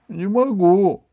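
put-on high, loud boy's voice; high-pitched helpless protest; whining refusal. A narrator's reading. Ivan refuses to get up.

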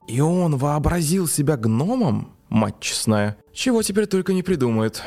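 put-on old gnome scoffing in disbelief; creaky old man. A narrator's reading. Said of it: "проскрипел дед".

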